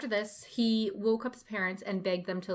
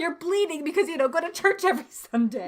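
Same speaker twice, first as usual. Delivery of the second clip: mock preacher; 4 semitones higher than usual. Mocking preachy pastor.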